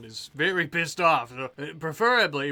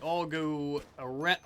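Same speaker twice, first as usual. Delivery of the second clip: ordinary talk, nothing marked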